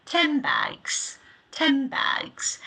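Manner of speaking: "Ten bags" said as "tem bags", lips closing before the B; connected speech demo; assimilated ten bags. Shown as a demonstration. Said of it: "it sounds like ten bags".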